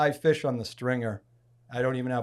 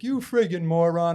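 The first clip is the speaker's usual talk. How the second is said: raised, louder ribbing; mock insult; 5 semitones higher, 7 dB louder than usual. Friend ribbing him after his ordeal on the rock, mock insult. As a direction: lightly imitate a buddy calling you an idiot.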